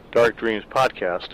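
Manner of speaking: plain reading voice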